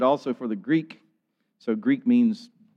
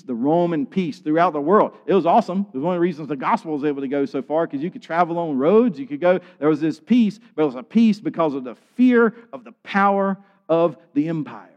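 louder, higher sermon voice; impassioned preaching; 5 semitones higher, 5 dB louder than usual. Real, not acted. Preacher telling the church of Rome's peace, impassioned. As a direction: preach it with mounting force.

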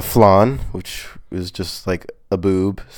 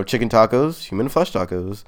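ordinary delivery

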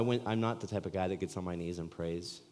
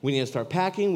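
excited urging, stress on packing; 7 semitones higher, 9 dB louder than usual. Retold or imitated. Repeated, higher and louder.

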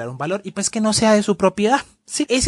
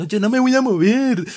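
retold yell; 5 semitones higher than usual. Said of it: "la otra gritando".

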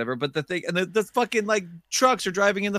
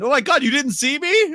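higher, indignant whine; mock jogger; 7 semitones higher, 3 dB louder than usual.

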